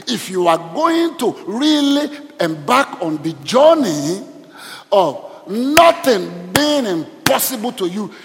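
loud, slow, driving sermon climax; fiery preaching. Acted. Preacher rousing the congregation against doubt, full-force preaching.

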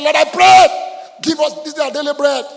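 desperate prayer shout. Retold or imitated. A starving woman crying out to God for bread: shouted.

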